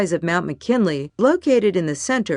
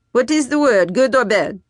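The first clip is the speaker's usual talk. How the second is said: put-on French-Canadian accent; 6 semitones higher, 4 dB louder than usual. A narrator's reading. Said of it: "his flowing French-Canadian accent".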